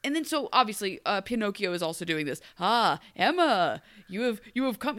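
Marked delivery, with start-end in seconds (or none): 2.6-5.0 a hint of tavern NPC voice; mock stilted villager. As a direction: greet her like a fantasy NPC. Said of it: "talking like an N P C".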